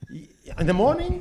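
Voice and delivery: high disbelieving question; can't believe it, voice climbing high; shocked. Real, not acted. Shocked at iced coffee.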